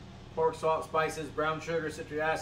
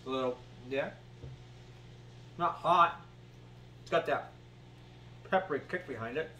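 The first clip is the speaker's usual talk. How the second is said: eating jerky while speaking; talking while chewing; 5 dB quieter than usual. Real, not acted. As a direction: mouth partly full. Seen 0: Holds jerky piece, puts it in mouth at 1.0–1.4, keeps talking.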